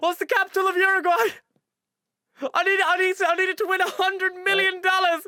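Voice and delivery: high, loud panicked plea; frantic mock call. Phoning a friend on a quiz show, hammed-up desperation. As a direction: beg down the phone in a hammed-up panic.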